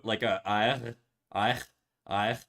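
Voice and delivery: R made at the back of the throat, a uvular R; throaty French R; French Canadian R. Shown as a demonstration. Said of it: "it'd be at the back of the throat".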